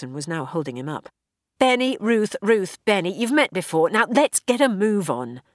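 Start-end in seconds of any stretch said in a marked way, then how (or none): 1.6-5.4 brisk, raised, hurrying voice; rushing everyone along; hurried introductions. Acted. Hurried introduction during escape.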